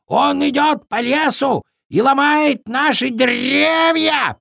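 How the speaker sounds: high panicked bird voice; frightened raven shout. A narrator's reading. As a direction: yell the alarm in fear. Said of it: "перепуганный ворон".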